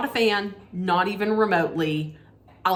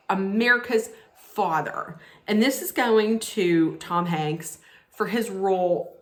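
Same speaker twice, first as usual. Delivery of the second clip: usual speaking voice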